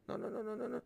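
ordinary talk

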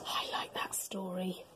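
soft whispered aside. Real, not acted. Reader's closing aside.

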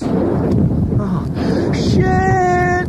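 very high, drawn-out wail; terrified scream. Acted. Fergie faces the oncoming fireball, terrified scream. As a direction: scream it long and loud.

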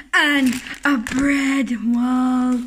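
ordinary talk, nothing marked

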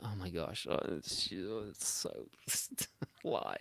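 breathy hushed dismay, laughing; a soft horrified whisper; appalled whisper. Retold or imitated. Recalling seeing exes again, whispering in dismay. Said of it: "I've been like".

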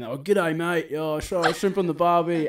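cringey, exaggerated fake Australian twang; mimicked Australian accent. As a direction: imitate tourists overdoing an Australian accent. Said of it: "people try to mimic an Australian accent".

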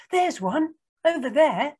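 put-on high, loud excited child; pointing out. A narrator's reading. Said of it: "pointed Tisha".

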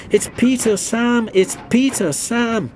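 high, loud panicked cry; wailing. A narrator's reading. Empty trucks warning the runaways, panicked high cry. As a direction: shriek out the warning.

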